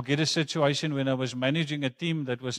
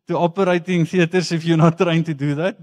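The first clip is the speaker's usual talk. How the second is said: laughing; 3 semitones higher, 9 dB louder than usual. Real, not acted. Jokes about doctors operating untrained.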